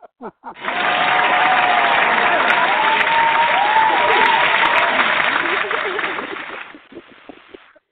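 long loud cackling laugh; loud burst of laughter. Real, not acted.

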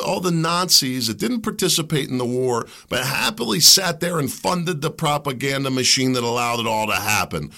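ordinary delivery

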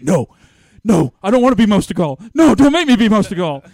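loud, high-pitched imitation of a pleading rookie; mock protest. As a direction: cry out in hammed-up refusal. Imitating rookie protesting his new gimmick.

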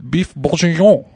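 amateur go at a French accent; attempted French accent. Shown as a demonstration. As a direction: try bourguignon in a French accent. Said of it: "the voice for a French accent".

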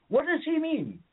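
woman turning to the others, high and confused; baffled woman. Retold or imitated. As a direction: ask the others, totally lost. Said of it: "And she's like".

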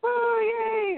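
high mock cheer; light mock whoop; crowd cheer. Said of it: "everybody's like".

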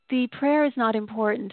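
ordinary talk, nothing marked

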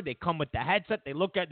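ordinary talk, nothing marked

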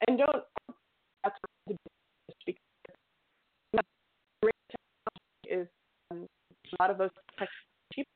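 breaking up over the line; choppy phone audio; bad connection. Real, not acted. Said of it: "you're going in and out".

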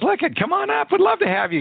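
an eager, welcoming call to come on up; a mock-bright, high-voiced invitation; excited invitation. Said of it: "He says".

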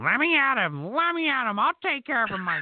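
mock a tiny dog itching to fight; high yappy Scrappy Doo voice. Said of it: "Little fucking Scrappy Doo".

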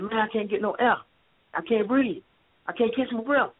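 rising, breathless pleading; desperate plea. Retold or imitated. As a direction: beg for air, pitch climbing. Kid pleading under officer's knee.

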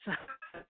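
quick high breathy chuckle; a light, brief laugh; short laugh. Real, not acted. Laughs after naming favourite artist.

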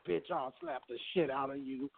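quiet flat robotic voice. Pretend. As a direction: murmur it like a robot box. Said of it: "In his robot voice".

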